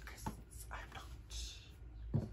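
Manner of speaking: a hushed, secretive whisper; whispered aside. Real, not acted. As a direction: lean in and whisper it like a confession. Teasing aside after a question.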